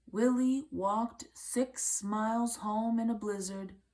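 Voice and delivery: ordinary delivery, nothing marked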